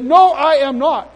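slow, high, loud refusal; defiant retort. Retold or imitated. Preacher standing up to immigration minister, defiant. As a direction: dig in word by word. He refuses her order again.

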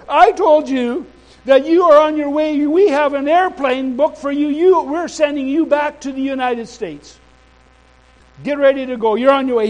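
high, loud acted tirade; angry insistence. Immigration minister repeating deportation order, angry. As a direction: hammer the order home as an irate official. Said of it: "she was very angry".

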